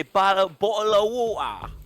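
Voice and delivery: high mock-British 'bottle of water'; exaggerated English accent with glottal stops; bad English accent. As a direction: put on a silly, high-pitched British accent. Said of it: "a shit English accent".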